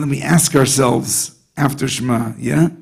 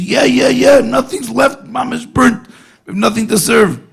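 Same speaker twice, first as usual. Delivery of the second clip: much higher, breathless mock panic; frantic brother; 9 semitones higher than usual. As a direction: squeal it out like a brother acting frantic. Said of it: "the guy's screaming".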